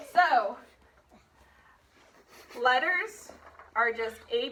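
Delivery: normal talking voice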